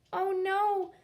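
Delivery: ordinary delivery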